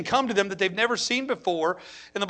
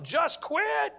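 lightly voiced Satan, higher pitched; tempting voice; 8 semitones higher than usual. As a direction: coax him to give up. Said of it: "Satan has whispered in my ear".

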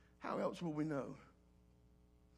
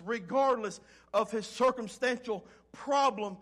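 rising, louder preacher's cadence, driving each phrase home; full-force preaching; 3 semitones higher, 8 dB louder than usual. Acted. Pastor urging the congregation through Jeremiah's trial, preaching loud. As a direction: preach it hard and build.

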